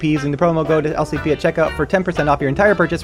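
fast, breathless rush; all in one breath. Real, not acted. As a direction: cram it all in without a breath. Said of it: "I'm gonna try and do this in one breath".

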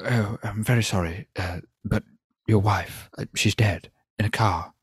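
a lightly voiced stiff British official breaking bad news; mild mock of a stilted polite Brit. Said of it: "it's all a bit stilted".

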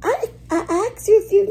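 hammed-up impression of the stammering staff member; mocking the staff. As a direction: mimic the stammering worker mockingly. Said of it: "these suckers was like".

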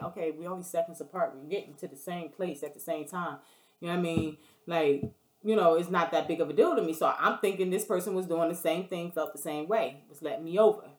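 normal talking voice

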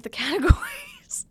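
breaking into giggles mid-sentence; laughing. Real, not acted. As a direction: giggle through the words. Laughing at her own joke.

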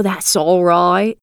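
put-on slow, soothing old crone; old peasant woman. A narrator's reading. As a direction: reassure her like a sly old woman. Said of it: "replied the peasant woman".

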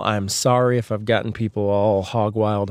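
ordinary talk, nothing marked

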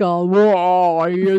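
loud, hammed-up ghostly taunt; mock spooky wailing. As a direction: draw it out loudly to tease someone scared. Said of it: "She's acting like".

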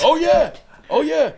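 very high, loud excited oh yeah; excited yelps. Real, not acted. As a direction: shout it out in delight. Excited agreement.

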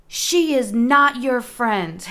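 slow, emphatic mock scolding; retold scolding scream. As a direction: imitate a stern dog owner, word by word. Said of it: "she's screaming".

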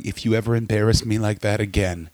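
low, contained menace, lightly acted. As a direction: threaten it low and controlled, in jest. Acted couple's threat in the car.

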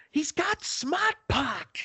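very high, slow, exaggerated Boston accent; thick Boston accent. Lady answers the man at the window, squeaky thick Boston accent. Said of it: "like, a thick Boston accent".

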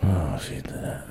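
breathy echo of the line; whispering. Real, not acted. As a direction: say it under your breath. Echoing partner under breath.

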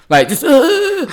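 squealing high with giddy laughter; nerdgasm voice. Shown as a demonstration. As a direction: geek out like the best scene just hit. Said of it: "That's my nerdgasm voice".

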